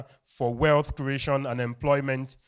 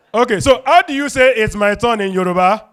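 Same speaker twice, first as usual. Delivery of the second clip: raised, loud MC question; loud hype; 7 semitones higher, 11 dB louder than usual. Real, not acted. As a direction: throw it to the crowd with energy. MC hypes crowd question.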